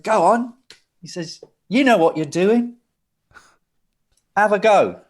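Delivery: high, loud egging-on; urging him on. Retold or imitated. John urging the hesitant assistant at the desk, insistent.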